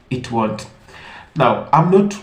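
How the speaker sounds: usual speaking voice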